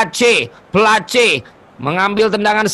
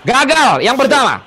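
excited commentary; 7 semitones higher, 3 dB louder than usual. Real, not acted. Live penalty shootout commentary.

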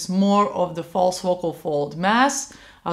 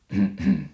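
low small throat-clearing cough; polite light cough. Shown as a demonstration. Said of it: "like a polite coughing when you want to draw attention".

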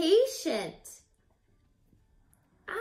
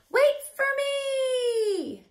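calling out; 10 semitones higher, slower than usual. Acted. Caterpillar calls after butterflies.

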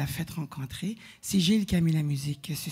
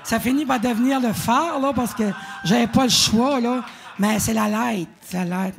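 louder Acadian chiac "la Light" in English; 3 semitones higher, 5 dB louder than usual. Real, not acted. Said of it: "je fais attention à mon accent".

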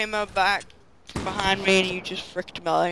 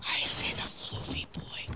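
soft unvoiced whisper; 12 dB quieter than usual. Real, not acted. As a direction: whisper it like a secret. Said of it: "you're just whispering it every time".

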